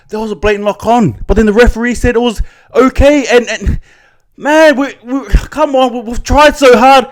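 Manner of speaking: high-pitched, pleading frustration; exasperated protest. Real, not acted. Fan pleading the team tried hard, exasperated. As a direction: voice climbing high as the protest builds. Pleading over a missed knock-on.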